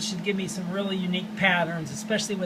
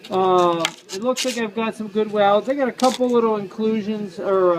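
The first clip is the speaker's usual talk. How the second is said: talking through a mask; 6 dB louder than usual. Real, not acted. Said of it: "I'm talking through a mask".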